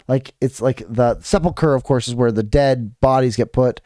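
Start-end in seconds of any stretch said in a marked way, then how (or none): none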